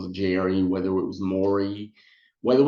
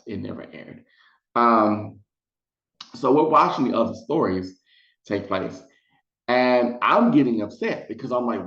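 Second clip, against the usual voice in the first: ordinary talk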